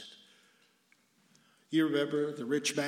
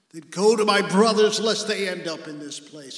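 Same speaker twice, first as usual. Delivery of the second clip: loud, raised plea, easing off at the end; crying out from suffering; 5 semitones higher, 10 dB louder than usual. Acted. Rich man begging for his brothers, crying out. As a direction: burst out urgently, then ease back. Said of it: "what he cries out from suffering".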